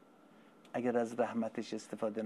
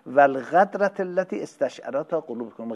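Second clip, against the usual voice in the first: full-force preaching; 4 semitones higher, 9 dB louder than usual. Acted. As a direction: proclaim it to the whole hall. Recites Arabic sermon. (خطابهٔ پرشور، بلند و کشیده)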